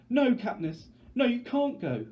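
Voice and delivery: a terrified little sister clinging on; hysterical screaming. Acted. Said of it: "Prim is screaming hysterically".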